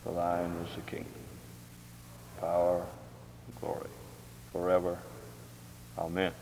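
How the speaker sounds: low, soft, measured prayer voice; recited prayer. Real, not acted. Closing the Lord's Prayer with a congregation, low and solemn recitation. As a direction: pray it gently, pausing between phrases.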